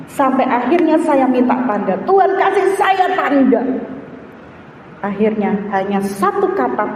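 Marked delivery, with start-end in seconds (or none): 2.1-3.6 high-pitched retold plea; imploring, raised voice; pleading prayer. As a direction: beg God for a sign.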